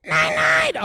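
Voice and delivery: loud, high shouted night night; yelled goodnight. Acted. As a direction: belt it out to annoy. Puppet yells goodnight, teasing.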